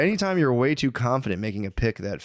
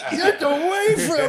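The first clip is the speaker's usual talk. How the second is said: high, slow, laughing mock shout of denial; much slower than usual. Old man denying the bra video, laughing shout. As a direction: shriek it like an outraged old man, cracking up. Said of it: "He's like".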